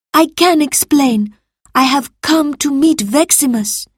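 high, loud anxious explanation; pleading. A narrator's reading. Salvius explaining himself to his master, pleading. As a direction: plead your case as a servant.

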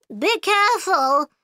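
high-pitched, mild story shout; shouted warning. A narrator's reading. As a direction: cry a warning, pitched well up. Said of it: "and shouted".

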